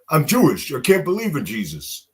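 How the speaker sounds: put-on old Brooklyn uncle protesting in a Brooklyn accent. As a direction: play up a thick Brooklyn accent. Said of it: "As I do the Brooklyn accent".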